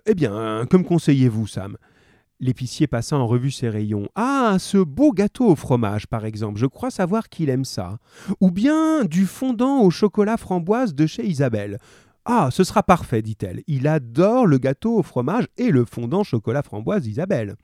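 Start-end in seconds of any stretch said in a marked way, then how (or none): 4.1-11.8 high, lightly voiced shopkeeper; friendly grocer. A narrator's reading. Grocer recommends cake. 12.2-13.2 high, eager, louder refusal; bright housewife. A narrator's reading. Mary pleased.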